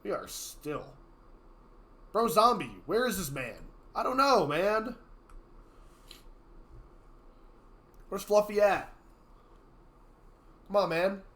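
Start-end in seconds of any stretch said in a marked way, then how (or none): 2.1-11.2 impatient. Real, not acted. Waiting impatiently for missing player.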